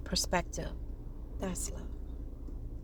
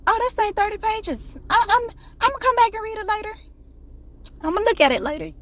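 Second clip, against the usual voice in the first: high, fast careless brush-off; mock high whiny voice; mock lazy excuse; 13 semitones higher, 3 dB louder than usual. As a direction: act out a flippant excuse. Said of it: "to all of my".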